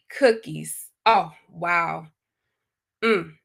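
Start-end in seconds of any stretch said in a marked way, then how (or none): none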